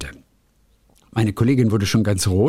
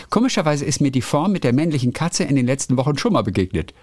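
ordinary talk

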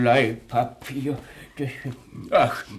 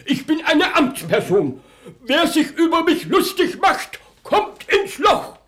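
high, loud outraged official; indignant shouting; 12 semitones higher, 6 dB louder than usual. Acted. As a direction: shout in offended fury. Policeman fumes at being mocked.

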